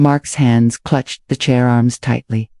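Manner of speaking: plain reading voice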